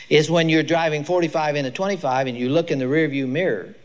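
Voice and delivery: ordinary talk, nothing marked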